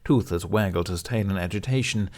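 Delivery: usual speaking voice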